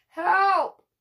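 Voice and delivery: a muffled plea for help; small muffled cry for help; whimpered. A narrator's reading. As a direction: cry out from somewhere trapped. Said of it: "a muffled voice whimpered".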